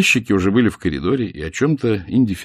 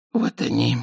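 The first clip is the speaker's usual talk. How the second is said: hoarse; 3 semitones higher than usual. A narrator's reading. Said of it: "осипшим голосом проговорил Малкин".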